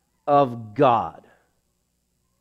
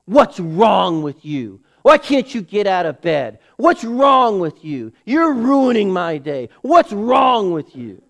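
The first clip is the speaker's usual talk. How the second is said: mock scolding parent; 2 semitones higher, 4 dB louder than usual. Preacher acting a harsh parent nagging a sick child, louder scolding. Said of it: "Do you look at that child and say".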